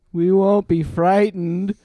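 very slow, high, projected reply; loud talking-to-aliens voice. A narrator's reading. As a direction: call it out slowly to an alien visitor. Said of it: "his loud talking to aliens voice".

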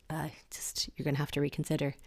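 quick, fast conspiratorial whisper. Real, not acted. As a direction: lean in and hiss the verdict under your breath. Dismissive aside after disapproval.

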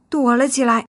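ordinary delivery

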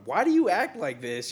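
loud, high exasperated question. Retold or imitated. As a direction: demand it, fed up and loud. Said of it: "I'm like".

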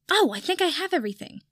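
high, bright sudden idea; sudden excitement. Acted. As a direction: burst out with the idea.

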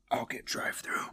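deadpan whisper of the line; whispered quote. Retold or imitated. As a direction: say it under your breath. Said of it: "And he goes".

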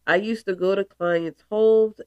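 ordinary delivery

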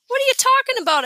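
high, loud, quick protest; indignant question. A narrator's reading. Peter answers Alex's teasing about love, indignant. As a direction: fire it back high and defensive. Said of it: "Alex mimicked".

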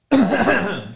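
hard choking cough. Real, not acted. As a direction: cough out the choke. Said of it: "Mi stavo strozzando".

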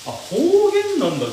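high, loud, slow exclamation; surprised realisation. Real, not acted. Streamer learning from chat it is dialect, surprised. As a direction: pitch leaps up as it dawns on you.

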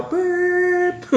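high steady beep; imitated censor bleep. Shown as a demonstration. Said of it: "edit bar".

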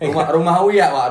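joking shout. Real, not acted. Shouting a joke answer.

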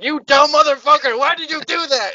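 high, loud mock-furious yell; outraged accomplice. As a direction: berate the partner who botched it. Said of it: "And you're like".

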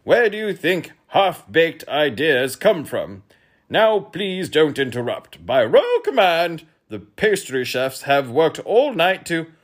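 high, loud, slow royal impatience; snappish king. A narrator's reading. King impatient at being interrupted, loud and high. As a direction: insist loudly, drawing the words out. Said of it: "snapped the king".

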